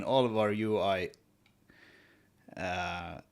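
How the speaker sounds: ordinary delivery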